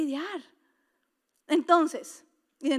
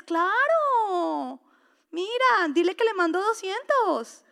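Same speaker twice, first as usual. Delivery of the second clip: eager husband; 5 semitones higher, 5 dB louder, much slower than usual. Retold or imitated. Husband voiced granting money.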